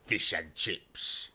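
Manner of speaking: put-on British accent. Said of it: "Does best British accent".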